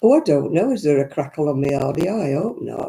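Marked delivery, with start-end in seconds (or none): none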